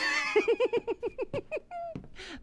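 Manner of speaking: a long high-pitched laugh at his own joke. Acted. Crew boss laughing at his own joke, long and high. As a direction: cackle high and long, pleased with yourself.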